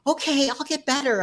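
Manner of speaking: lightly voiced mother promising; a hint of mom, breezy; mother's voice. Voicing her mother's reply.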